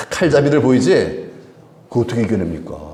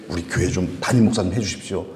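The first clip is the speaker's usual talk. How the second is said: ordinary talk, nothing marked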